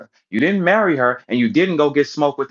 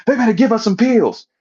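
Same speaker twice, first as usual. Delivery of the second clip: mocking demand; 10 semitones higher, 6 dB louder than usual. Mocking Holla demanding pills.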